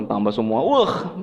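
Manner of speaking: ordinary delivery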